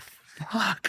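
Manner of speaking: high-pitched exasperated curse; retold dismay. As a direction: curse your rotten luck in retelling. Retells reaction to rival's opening.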